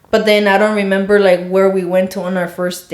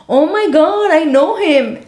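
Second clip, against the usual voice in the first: high-pitched, over-excited mock gasp of recognition; exaggerated imitation; 8 semitones higher than usual. Girlfriend retells boyfriend recognizing her dad, hammed-up excited imitation. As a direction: imitate him squealing at a sudden discovery. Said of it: "I didn't say it like that".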